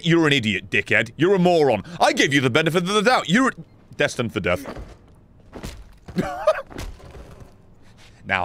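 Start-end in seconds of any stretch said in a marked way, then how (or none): 6.1-6.9 short high burst of laughter. Real, not acted. Gamer laughing at a doomed character, laughing.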